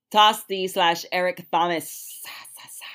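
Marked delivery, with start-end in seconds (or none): 2.2-2.9 whispering. Acted. Whispering guest names, dramatic sign-off.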